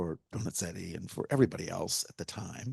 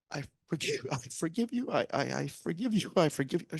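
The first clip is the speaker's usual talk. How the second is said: higher, strained and halting I forgive you; laborious forgiveness; 7 semitones higher than usual. Retold or imitated. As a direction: force out each I forgive you, struggling. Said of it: "she sings over and over again, laboriously".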